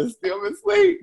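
high, loud mock complaint; exasperated high outburst. Real, not acted. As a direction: squeal it out in protest. Complaining about early wake-up.

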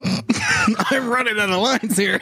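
high-pitched, frantic fluster over missing lines; comic panic. Acted. Actor runs out of lines mid-scene, comic panic. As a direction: blurt it out in a high, theatrical panic.

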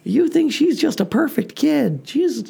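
put-on high, slow indignant kid whine; imitating mad kid. Son complaining about his sister, high mock-mad kid voice. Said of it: "he's getting all mad".

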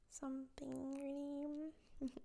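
whispered aside. Real, not acted. Laughing it off.

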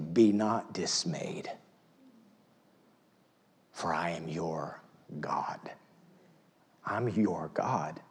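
slow hushed whisper; tender whispered divine promise. Pretend. Voicing God tenderly after climax.

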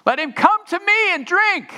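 high, loud crying out to the crowd. Acted. Jesus crying out to the crowd, high and loud. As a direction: call out to everyone in the temple. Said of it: "he cries out in a loud voice".

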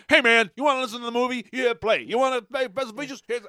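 high, loud, fast Donkey voice; Eddie Murphy impression. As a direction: hype it up like Donkey. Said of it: "Eddie would be like".

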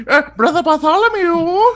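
high squeaky voice, laughing through it. Pretend. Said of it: "This is the voice you're gonna use".

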